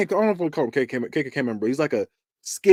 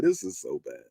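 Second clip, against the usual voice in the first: disappointed mutter; 11 semitones lower, 8 dB quieter than usual. Retold or imitated. Reacting to a bad movie.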